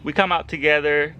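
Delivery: normal talking voice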